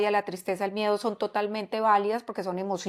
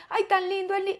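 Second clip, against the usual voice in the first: doting parent; 8 semitones higher than usual. Retold or imitated. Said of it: "hay papás que se ríen".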